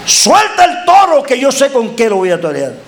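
high bullfighter's call falling away; defiant challenge. Acted. Preacher's defiant challenge, called out. (desafío gritado con bravura)

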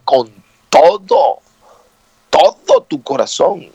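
slow "todo", paused, then repeated with weight; stress on TODO. Shown as a demonstration. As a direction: hammer "todo" twice with a pause between. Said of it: "Y escucha cómo dice".

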